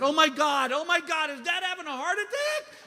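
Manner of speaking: high, mock-panicked outburst; alarmed. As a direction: react to bad news in exaggerated alarm. Acts alarm at father's chest pains.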